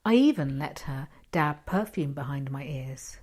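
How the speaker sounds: ordinary delivery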